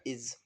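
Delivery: इज said with a z ending, iz; इज pronounced iz, not is; word demo. Shown as a demonstration. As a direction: say इज as iz with a buzzing z. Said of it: "इज हो जाएगा".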